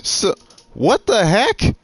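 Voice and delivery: ordinary talk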